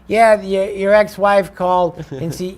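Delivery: a cheery assistant breaking news; mock messenger. Messenger voiced in story.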